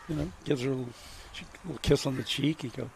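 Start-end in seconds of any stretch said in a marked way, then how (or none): none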